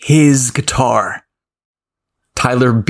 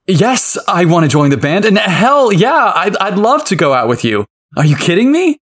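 high, eager burst of joy; excited; 7 semitones higher than usual. Acted. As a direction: blurt it out, thrilled and breathless. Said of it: "couldn't answer fast enough".

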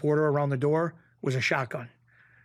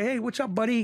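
high, sing-out buddy greeting; imitated friendly hello; hearty greeting; 8 semitones higher than usual. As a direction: greet an old pal in a raised pitch. Acting out Bruno's usual greeting.

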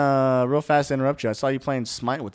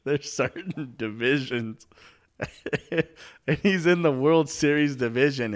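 stifled giggles. Real, not acted. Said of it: "I'm trying not to laugh".

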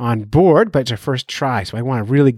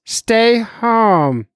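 all caps; 8 semitones higher than usual. Retold or imitated. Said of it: "all caps".

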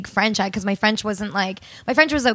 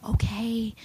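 hushed okay; 7 dB quieter than usual. Retold or imitated. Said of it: "I'd be like".

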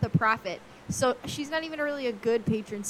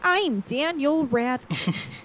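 put-on slow, overdone American accent, slightly raised; Daniel Radcliffe's American accent; 4 semitones higher, 3 dB louder, much slower than usual. Podcaster parodies an actor's unsettling American accent, slowly overdone. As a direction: drag out a stiff, careful American accent. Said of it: "doing a very unsettling American accent".

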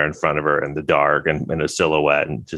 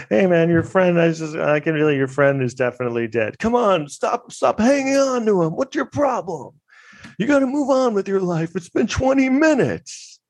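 mock exasperated badgering in a very high voice; hammed-up impatient pep talk, pitched way up; Viper; 14 semitones higher than usual. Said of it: "Tom Skerritt goes".

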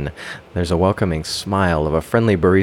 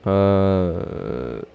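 doubtful; 4 semitones higher, slower than usual. Acted. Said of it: "with a bit of doubt in my voice".

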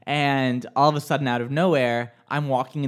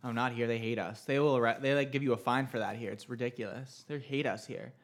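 low, soft grumble; muttering; 5 semitones lower, 10 dB quieter than usual. Real, not acted. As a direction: grumble it under your breath. Complaining about strict local rules.

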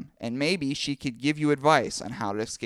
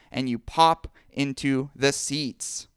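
usual speaking voice

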